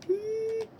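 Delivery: sudden high squeal. Real, not acted. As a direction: squeal in surprise. High squeal during game fight.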